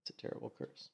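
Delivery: breathy whispered aside; whispering. Real, not acted. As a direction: whisper it under your breath. Muttered repeat of the line.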